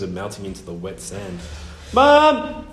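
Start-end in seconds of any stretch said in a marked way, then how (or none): 1.9-2.7 high, loud calling shout. A narrator's reading. Boy calling his missing parents across the bay, shouting. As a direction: call out loudly for someone far off. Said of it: "he shouted".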